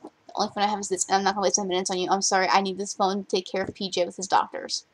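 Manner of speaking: usual speaking voice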